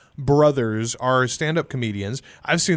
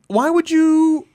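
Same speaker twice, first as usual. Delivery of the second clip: exasperated; 12 semitones higher, 5 dB louder, slower than usual. Real, not acted. Exasperated disbelief.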